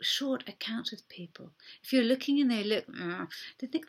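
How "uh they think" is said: ordinary talk, nothing marked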